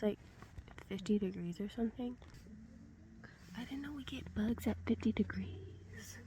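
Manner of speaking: soft morning whisper. Real, not acted. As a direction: whisper so neighbors sleep. Early morning tent whisper.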